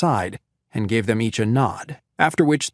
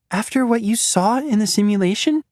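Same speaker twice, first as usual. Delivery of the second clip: Natalia voiced; 10 semitones higher than usual. Natalia questions Grace.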